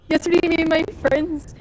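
laughing through the word; giggling as she starts. Real, not acted. Laughing while starting story.

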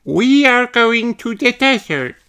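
put-on high, bright little elephant girl voice; Emily Elephant voice. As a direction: voice a cheerful young elephant.

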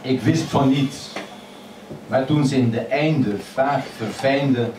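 normal talking voice

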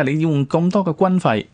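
usual speaking voice

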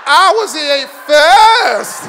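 high, drawn-out mock shout; shouted retort. Comedian claims he was first, loud acted shout. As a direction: belt it out as a slow punchline.

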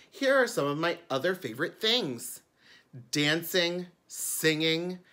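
bright storytime reading; children's book narration. A narrator's reading. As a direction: read it like a picture book to kids. Reads the book aloud.

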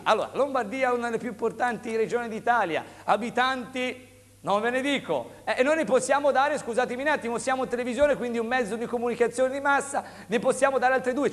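high, pushy sales pitch; infomercial. Real, not acted. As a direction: hype the offer like a TV seller. Host announces more units.

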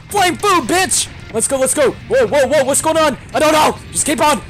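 high, loud, excited fight chatter; frantic battle yelling. Real, not acted. Gamer mid boss fight, frantic yelling. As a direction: yell through the thick of a fight.

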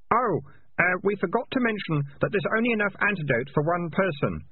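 high-voiced boy calling an afterthought; casual afterthought. A narrator's reading.

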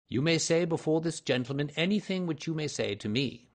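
high, self-assured gentleman sleuth; assured Holmes. A narrator's reading. Voices Holmes answering the Count.

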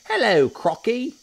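very high, loud monkey greeting; Mugglewump. A narrator's reading. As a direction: squeal hello as a cheeky monkey. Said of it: "said Mugglewump".

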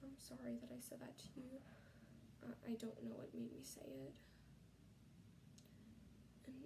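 soft, unvoiced apology; barely-there whisper, ashamed. Apologizing softly to George.

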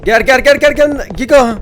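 loud, high repeated calls; calling out to Giga for help. Real, not acted. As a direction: call the fish to come over. Calling the giant fish over.

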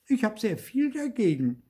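high-pitched puppet objection; indignant refusal. A narrator's reading. Puppet refuses farmer's order. (hoch und empört)

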